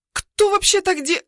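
high loud indignant rant; rising outraged rant. A narrator's reading. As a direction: rant until interrupted. Maid ranting, cut off.

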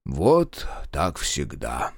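mock complaint, low and very slow; посетовал с удовольствием. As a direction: grumble while hiding your pleasure. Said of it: "посетовал Марков с плохо скрываемым удовольствием". (притворно сетуя, очень медленно и низко)

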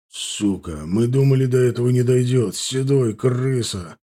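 understated angry exclamation; angry outburst. A narrator's reading. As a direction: swear in anger, held short of a shout. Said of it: "выкрикнул Сом и выматерился".

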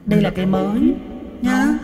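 very high, loud demon voice. Shown as a demonstration. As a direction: announce it as a shrieking demon. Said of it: "Nghe nó rợn hơn đúng không".

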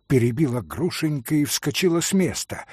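ordinary delivery

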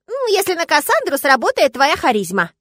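put-on high, sly old man voice; high old forest spirit. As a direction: slyly set the condition. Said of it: "Вот такая у меня хотелка".

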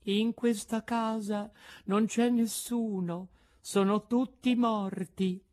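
high, thin voice from another world; a small girl sounding already dead; ghostly little voice. A narrator's reading. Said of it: "con una vocina che pareva venisse dall'altro mondo". (vocina dall'oltretomba)

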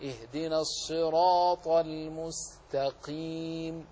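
المستقيم with a clean, clear sin, not a sad; slow model recitation. Shown as a demonstration. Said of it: "والنطق بها صحيحا".

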